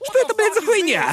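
put-on high, heavy Australian accent. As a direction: blurt it in a thick Australian accent. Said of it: "с жёстким австралийским акцентом".

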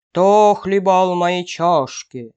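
slow, loud big-bear roar; fearsome bear roar. A narrator's reading. Big bear finds his bowl used, fearsome roar. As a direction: roar it out slowly and heavily. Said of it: "заревел страшным голосом".

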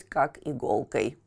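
ordinary delivery, nothing marked

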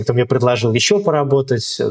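ordinary talk

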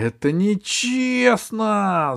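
high, very slow sulky cry; whiny shout. Acted. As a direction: wail it like a cheated cat. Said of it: "закричал вслед кот".

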